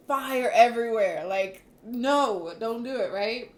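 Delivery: loud fiery outcry about revenge; fiery outburst. Real, not acted. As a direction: blast it out like dragon fire. Pictures a fire-breathing dragon.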